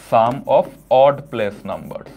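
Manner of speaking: "sum of odd place numbers" stretched out very slowly; very slow dictation. Shown as a demonstration. Teacher dictates step two of the rule, very slow dictation. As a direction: drag each word out for the class to copy. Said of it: "আমি কিন্তু খুব আস্তে আস্তে বলবো".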